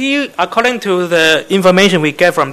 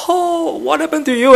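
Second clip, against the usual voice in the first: mock tearful visitor; 11 semitones higher than usual. Visitor wailing over a patient in hospital, mock tearful. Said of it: "then you started to cry".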